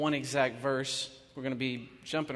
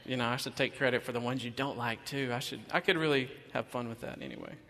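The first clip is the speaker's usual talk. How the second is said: ordinary talk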